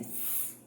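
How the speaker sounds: soft voiceless "sss"; S hissing sound. Shown as a demonstration. Teacher contrasting S with Z, soft hissed ss. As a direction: let out a quiet S hiss. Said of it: "não S".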